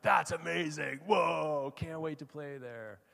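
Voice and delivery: high, drawn-out mock cheering; excited teammates. Teammates hearing of a new baseball diamond, excited. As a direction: imitate thrilled kids reacting in a group chat.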